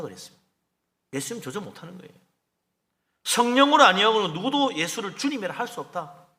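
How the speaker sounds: normal talking voice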